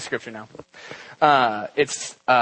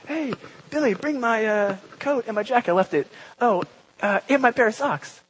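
much higher, breezy, offhand asking a friend; casual text message; 9 semitones higher than usual. Retold or imitated. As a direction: toss it off like a quick text. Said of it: "Like a text message".

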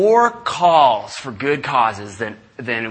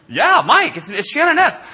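much higher, laughing and delighted; delighted recognition; 12 semitones higher, 5 dB louder than usual. Retold or imitated. Wife laughing as she recognizes Mike on the phone. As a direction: squeal the name out with a laugh.

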